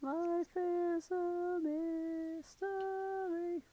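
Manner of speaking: mock-melancholy lament, very slow; mock wistful sigh. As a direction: sigh it out like a tragic poet. Wistful joke about niche art.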